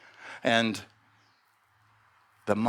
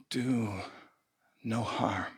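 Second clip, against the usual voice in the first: murmured mantra; 3 semitones lower, 7 dB quieter, slower than usual. Acted. Snake repeats mantra to itself.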